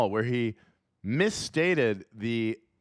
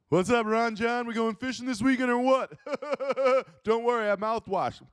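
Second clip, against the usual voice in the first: much higher, bro calling his pal; high buddy voice; 14 semitones higher than usual. Pretend. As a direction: greet your fishing buddy in a high goofy voice. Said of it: "his friends ... call him Ron John".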